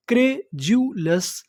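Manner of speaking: "क्रे जू लस" slowly, as three separate beats; broken into syllables. Shown as a demonstration. Teacher putting the word together, syllable by syllable. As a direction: sound out "क्रे जू लस" with a gap after each part. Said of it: "टुकड़ों में तोड़-तोड़ के पढ़ना है".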